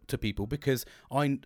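usual speaking voice

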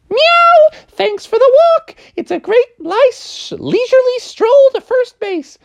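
very high sweet kitten voice; teasing kittens. Acted. Kittens teasing Froggy, very high and sweet. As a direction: thank him sweetly for the walk.

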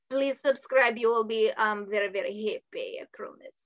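stern mock-Russian instructor; bossy Russian-accented sales pitch. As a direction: lay down orders in a put-on Russian accent. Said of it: "you have to do a Russian accent".

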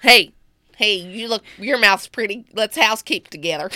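put-on Southern-accented come-on; jokey Southern flirting; mock Southern pickup line. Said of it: "I like how you're Southern".

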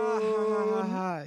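hammed-up fake audience laugh; mock laughter.

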